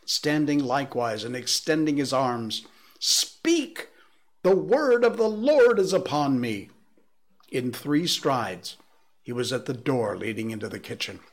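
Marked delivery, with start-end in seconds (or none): 3.0-6.7 high, much louder defiant shout. A narrator's reading. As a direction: roar it at the top of the lungs. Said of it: "shouted the curate at the top of his voice".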